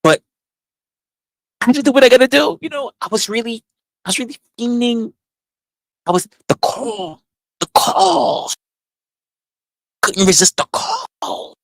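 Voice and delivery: high, straining voice giving in to the urge; high desperate craving. Acted. Telling the group how the urge won, high and strained. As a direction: pitch it up like the craving took over.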